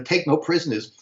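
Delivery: ordinary talk, nothing marked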